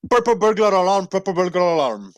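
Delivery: quick Puerto Rican accent, said twice in a row. Shown as a demonstration. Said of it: "in your Puerto Rican accent".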